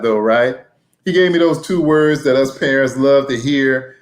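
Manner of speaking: normal talking voice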